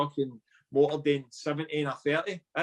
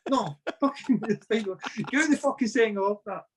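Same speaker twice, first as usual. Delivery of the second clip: higher, slow, through laughter; laughing gaffer; 8 semitones higher, slower than usual. Retold or imitated. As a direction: wave him off while laughing. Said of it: "And the gaffer said".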